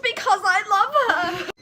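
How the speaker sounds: loud, high, laughing shriek. Acted. Draco defending his love, loud high shriek. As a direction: shriek the confession. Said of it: "Draco shrieked".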